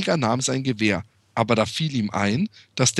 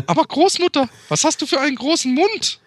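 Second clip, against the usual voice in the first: put-on much higher, louder startled girl; high little girl; 13 semitones higher, 4 dB louder than usual. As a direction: squeal it like a frightened little girl. Little Red's final question.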